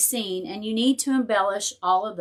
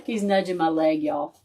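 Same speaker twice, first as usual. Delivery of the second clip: ordinary talk, nothing marked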